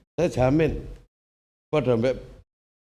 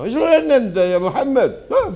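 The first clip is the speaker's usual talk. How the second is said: scolding shout; 12 semitones higher, 6 dB louder than usual. Retold or imitated.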